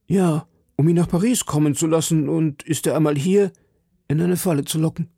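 worried citizen. Acted. Citizen confiding in D'Artagnan about the plot, lightly voiced worried citizen.

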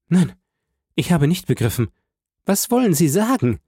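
high, bewildered woman's voice; understated crushed disbelief; crushed stammer. A narrator's reading. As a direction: ask it as if devastated, voice rising. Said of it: "stammelte völlig niedergeschmettert".

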